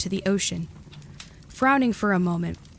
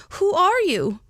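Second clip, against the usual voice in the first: much higher, louder call; 11 semitones higher, 5 dB louder than usual. A narrator's reading. Edith calls after the couple down the path, high and loud. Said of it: "she called".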